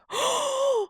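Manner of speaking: high, drawn-out gasp; imitated shocked gasp. Girl sees the patient in the overflow room, gasping. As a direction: mimic an onlooker's sharp gasp. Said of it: "A girl immediately goes".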